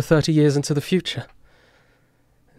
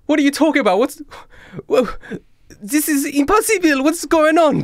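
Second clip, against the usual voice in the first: very high, louder panicked cry; panicked disbelief; 4 dB louder than usual. Acted. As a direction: shriek in disbelief.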